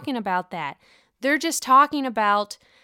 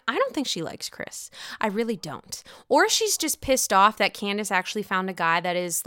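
normal talking voice

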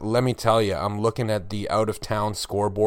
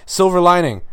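announcing; 8 semitones higher, 8 dB louder than usual. Real, not acted. Segment title announced loudly.

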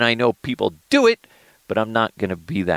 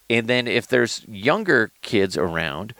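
ordinary talk, nothing marked

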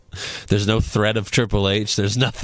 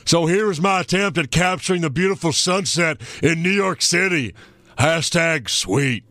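lightly voiced gruff Stone Cold, higher; Stone Cold impression; 6 semitones higher than usual. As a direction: read the tweet as Stone Cold. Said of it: "Stone Cold".

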